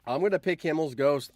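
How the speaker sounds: normal talking voice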